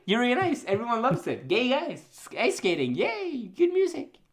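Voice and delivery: high, mock cheerful listing; sarcastic cheer. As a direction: fake enthusiasm, rising to a squeal.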